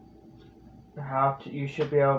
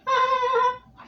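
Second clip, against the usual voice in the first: very high, slow squeezed voice; SpongeBob voice. Shown as a demonstration. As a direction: pinch it up into the head. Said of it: "I still can't do it for some reason".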